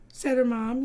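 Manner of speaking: ordinary delivery, nothing marked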